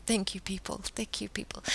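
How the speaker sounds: soft breathy whisper, almost to herself; whispered thanks. Real, not acted. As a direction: murmur the thanks under the breath. Grateful aside to commenters.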